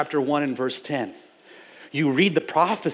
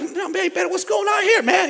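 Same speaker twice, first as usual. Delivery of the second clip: much higher, mock-indignant; fired-up quote; 13 semitones higher than usual. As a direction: voice his outrage. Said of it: "I get fired up too".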